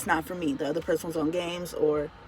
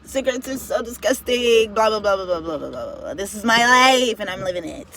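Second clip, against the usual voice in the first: mocking judgmental viewer; 4 semitones higher, 7 dB louder than usual. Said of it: "To my viewers that's gonna be like".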